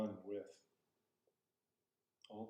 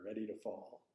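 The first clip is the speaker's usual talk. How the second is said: usual speaking voice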